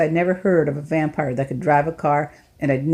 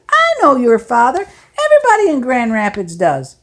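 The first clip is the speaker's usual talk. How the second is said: a hint of a genial older man; an older driver talking to a runaway boy, lightly voiced; 6 semitones higher, 8 dB louder than usual. Narrator voices Lefty Lewis.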